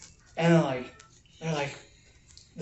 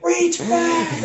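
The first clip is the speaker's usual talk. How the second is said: much higher, louder shouted command; yelled order; 14 semitones higher, 8 dB louder than usual. Retold or imitated. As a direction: snap it up at the kid like a sergeant. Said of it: "they're just yelling at him".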